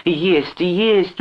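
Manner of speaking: very high, insistent repetition; urgent persuading. A narrator's reading. Sister insists to her brother, urgently persuading. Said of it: "уговаривала Джон".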